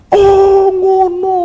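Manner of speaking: high, loud drawn-out oh of discovery; sudden realisation. Retold or imitated. Pupil suddenly understands. (seruan "oh" tiba-tiba paham, tinggi dan keras)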